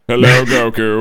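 loud silly villain greeting; hammed-up Cell hello; mock villain voice; stupid voice. Shown as a demonstration. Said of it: "I know I did it in a stupid voice".

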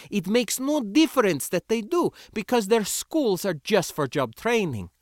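put-on high-pitched, accented indignation; flushed and angry. A narrator's reading. Sindra justifying rebellion to the spacer, angry. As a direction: argue hotly in a foreign accent. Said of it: "flushed and angry again".

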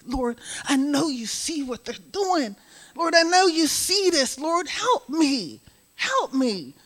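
high, pleading cry to the Lord. Retold or imitated. Telling the church how she cried out, high pleading. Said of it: "I'm crying out".

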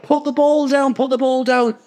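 imitating teammates shouting at him; high mock team yell. Teammates telling him to ground the ball, high mock yell. Players yelling at the try line.

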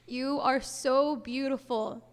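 slow, hushed opening of a prayer. Acted. Speaker leading the audience in prayer, reverent. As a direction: pray with reverent pauses.

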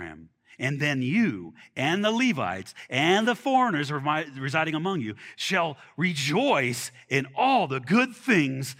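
slow, measured reading of the passage; scripture reading. Acted. Preacher reading scripture to the congregation, measured. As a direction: read the verses out with weight.

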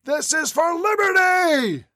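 high, slow, drawn-out rallying cry; battle cry. Acted. Terrorist leader proclaiming his cause, high drawn-out cry. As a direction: pitch it way up and stretch out the slogan.

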